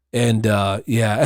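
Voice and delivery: ordinary talk, nothing marked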